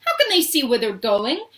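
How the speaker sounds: a touch of shrieking alarm; mild shriek. A narrator's reading. Violet in the dark tunnel asking about the rowers, mildly shrieked. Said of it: "shrieked Violet Beauregard".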